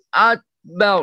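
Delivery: ordinary talk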